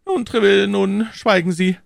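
high, loud royal call to silence; king calling out. A narrator's reading. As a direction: cut him off sharply. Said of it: "rief der König".

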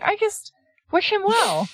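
high, light innocent voice; airy innocent Kato. Retold or imitated. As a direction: play the clueless sweet guy. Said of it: "Kato's just like".

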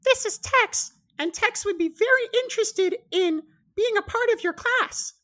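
very high, loud squeaky bird; small talking parrot making introductions; tiny parrot voice. Acted. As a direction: announce your friend in a piercing little squeak. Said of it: "and goes".

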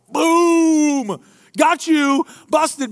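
loud, high-pitched gotcha; mock triumph. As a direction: crow it like a winning blow. Imitates questioners' mic-drop triumph.

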